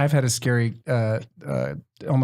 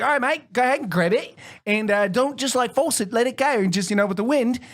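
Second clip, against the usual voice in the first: lightly done Australian instructor voice, pitched higher; Australian accent; 5 semitones higher than usual. Retold or imitated. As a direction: coach them like a laid-back Australian guide. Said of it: "And that's my Australian accent".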